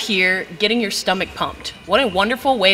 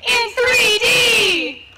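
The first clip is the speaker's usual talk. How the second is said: high, slow, shouted announcer voice; announcer intro; 13 semitones higher, slower than usual. Acted. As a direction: bellow it like a cheesy TV host.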